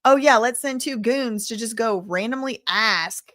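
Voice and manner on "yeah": ordinary talk, nothing marked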